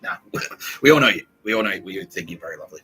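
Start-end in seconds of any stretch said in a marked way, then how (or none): 0.8-1.2 louder, emphatic mock reassurance. Hosts reassuring [name], louder emphatic. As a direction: call it out to her, emphatic.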